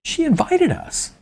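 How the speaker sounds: high, thin squeak. A narrator's reading. Rattled councilman defending himself to the crowd, high squeak. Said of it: "he squeaked out".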